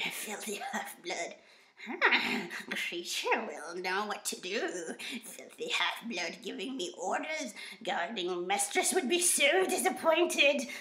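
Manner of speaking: disgruntled, grumpy creature, slow and muttered; put-on grumpy house-servant creature voice; Kreacher. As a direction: grumble it slowly under your breath. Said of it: "I love doing the Kreacher voice".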